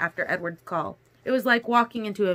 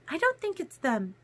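high, softly insistent voice; insistent Alice; 8 semitones higher, 4 dB quieter than usual. A narrator's reading. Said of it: "Alice insisted".

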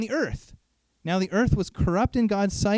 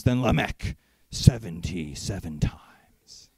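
deep Lamech voice; 10 semitones lower than usual. Retold or imitated. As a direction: boom like a proud brute king, then hush. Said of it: "like, deep voice or something".

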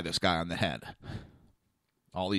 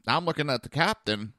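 ordinary talk

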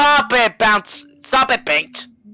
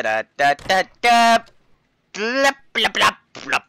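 usual speaking voice

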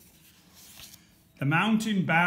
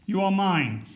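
high, loud proclamation; 6 semitones higher, 5 dB louder, slower than usual. A narrator's reading. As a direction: shout it across the water. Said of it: "said in a loud voice".

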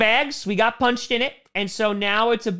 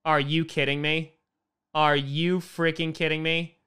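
low, subdued and stunned; quiet exasperation; deflated disbelief; 8 semitones lower, 5 dB quieter than usual. Real, not acted. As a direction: say it low, beaten down. Fan reacts to another bad play.

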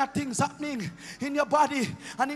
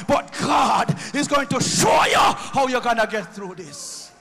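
fervent preaching, louder toward the end; preaching at full force; 6 dB louder than usual. Acted. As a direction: proclaim it to the young people. Preacher raises to declaration.

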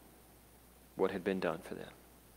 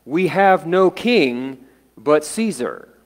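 loud, higher, drawn-out declaration; yelled out; 4 semitones higher, 5 dB louder, slower than usual. Retold or imitated. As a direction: call it out slowly and forcefully. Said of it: "yelled out".

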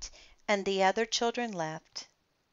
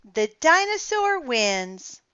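put-on children cheering; 7 semitones higher, 8 dB louder than usual. A narrator's reading. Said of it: "said the children".

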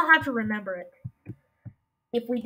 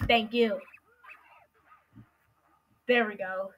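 ordinary talk, nothing marked

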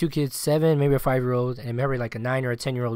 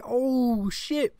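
shocked oh shit; 11 semitones higher than usual. Retold or imitated. Shock at dog escaping.

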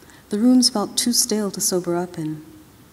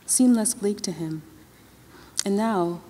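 ordinary delivery, nothing marked

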